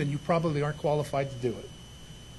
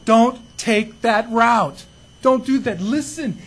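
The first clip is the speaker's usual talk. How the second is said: loud warning; 4 semitones higher, 7 dB louder, slower than usual. Retold or imitated. Pastor warning a wandering sheep.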